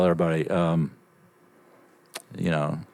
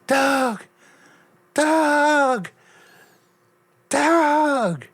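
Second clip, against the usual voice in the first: very high, drawn-out calling; imitated grandma yelling; 3 dB louder, much slower than usual. Grandma running through the store calling her grandson, high mock yell. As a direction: mimic a far-carrying holler in falsetto. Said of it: "when she's yelling".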